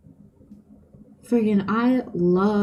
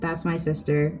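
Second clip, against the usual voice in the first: ordinary talk, nothing marked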